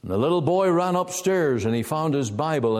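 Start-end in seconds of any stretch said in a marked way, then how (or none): none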